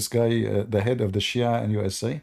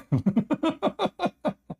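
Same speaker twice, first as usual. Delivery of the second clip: high laugh. Real, not acted. Laughs at story.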